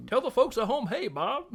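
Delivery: high, loud booming announcer. Retold or imitated. Announcer Chris Berman calling up to the booth. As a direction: hype it up like a TV play-by-play man.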